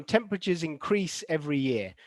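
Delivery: teacher's model of in-CREASE, the verb "increase"; stress on second syllable. Shown as a demonstration. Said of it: "this one is the second syllable".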